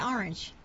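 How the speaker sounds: casual "orange"; relaxed local "orange"; unthinking pronunciation. Shown as a demonstration. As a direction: say orange naturally, New York style. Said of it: "if I'm not thinking about it".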